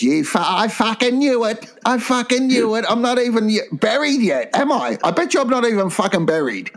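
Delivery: high, indignant mock wife; high-pitched impression of a wronged wife; dead wife's voice. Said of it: "your dead wife's voice".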